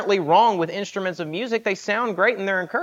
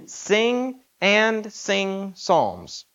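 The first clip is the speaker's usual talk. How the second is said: "Sing and sing psalms" drawn out very slowly, each word weighted; slow emphasis; 3 semitones higher, much slower than usual. Shown as a demonstration. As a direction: lean hard on every word of "Sing and sing psalms". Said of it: "You put emphasis behind this".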